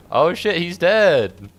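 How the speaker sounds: loud, high, drawn-out exclamation; startled cry. Real, not acted. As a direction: call it out in sudden shock. Sudden discovery during play.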